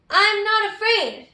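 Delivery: loud and bold, chin up; brave shout. Shown as a demonstration. Teacher modeling a brave voice for the kids, loud. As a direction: say it like you mean it, not afraid. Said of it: "Can you say".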